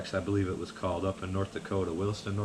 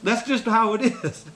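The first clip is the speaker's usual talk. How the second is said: mock exasperated; 14 semitones higher, 6 dB louder than usual. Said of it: "I said".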